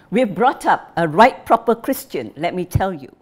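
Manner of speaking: put-on an indignant old father lecturing; angry father. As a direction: berate your wife, voice up. Father scolding his wife.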